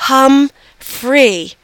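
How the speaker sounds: slow, broken into two syllables; hesitant attempt. A narrator's reading. Frightened mother tries the English name, halting and careful. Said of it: "looked a little frightened, but she tried".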